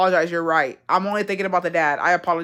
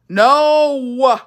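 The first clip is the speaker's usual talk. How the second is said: sharp loud no, pitched up; yell; 5 semitones higher, 6 dB louder than usual. Real, not acted. Yelling no at a confusing family tree. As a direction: yell it out in protest. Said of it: "Your yell was funny as fuck".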